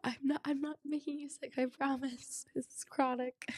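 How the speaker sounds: high, mock-pleading self-defence; acted high-voiced promise; pleading reassurance. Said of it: "to explain I wasn't contagious".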